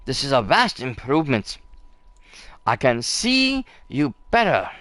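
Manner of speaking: put-on a light French accent on the character's lines; lightly voiced French chef, gracious. Player reads the chef's thanks in a light French accent. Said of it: "my French is a little terrible".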